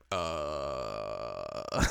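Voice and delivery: long, very low stalling noise; awkward low groan. Retold or imitated. Bassist asked about other bands, stumped low groan. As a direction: sink into a soft, stumped groan.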